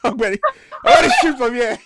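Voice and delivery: high, loud laughing yell; laughing shout. Real, not acted. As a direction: shriek it through laughter. Shrieking laughter at the song.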